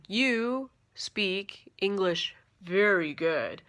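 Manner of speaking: very slow, spelled out as if to a foreigner; slow, overly clear. Retold or imitated. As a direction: stretch each word, loud and careful. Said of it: "speak slower and use some sort of hand language".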